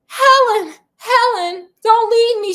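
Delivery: high, loud desperate wail; crying out. A narrator's reading. Heather calls to the vanishing ghost, crying out desperately. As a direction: call after her in desperation, pitch leaping. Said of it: "she cried".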